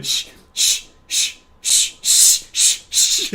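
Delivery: rapid whispered shh-shh; shushing battle. Retold or imitated. Dead people and killer shushing each other in a fight. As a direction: fire shushes back and forth.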